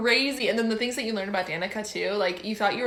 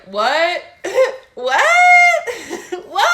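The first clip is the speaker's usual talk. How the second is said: squealing, much higher, louder laughing what; shocked what; 9 semitones higher, 13 dB louder, slower than usual. Retold or imitated. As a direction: yelp it over and over through laughter. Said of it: "it was just like".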